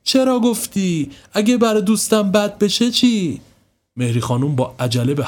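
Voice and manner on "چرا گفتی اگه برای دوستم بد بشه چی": much higher, very slow hurt complaint; a girl betrayed by her friend; upset reproach. Acted. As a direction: stamp and blame him. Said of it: "از شدت دلخوری پایش را روی زمین کوبید".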